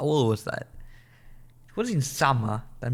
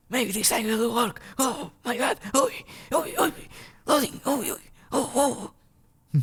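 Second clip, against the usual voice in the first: excited anticipation; 11 semitones higher than usual. Real, not acted. Game loading may finally work.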